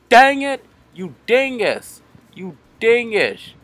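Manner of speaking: high angry yell at the rival; shaking with anger. Real, not acted. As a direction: snap it out in rage. Killed by rival.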